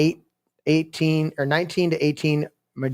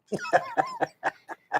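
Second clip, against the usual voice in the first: a high burst of laughter; 6 dB quieter than usual. Real, not acted. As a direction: crack up at the joke. Laughs at own impression.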